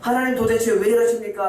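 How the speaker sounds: high pleading cry. Acted. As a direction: cry out the question, pitched high. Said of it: "엎드려서 기도하고 있는데".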